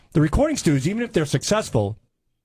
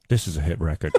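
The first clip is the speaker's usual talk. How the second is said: imitating the producer; 10 semitones lower than usual. Producer quoted, feet on desk.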